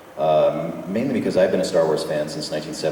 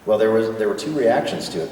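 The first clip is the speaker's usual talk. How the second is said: ordinary talk, nothing marked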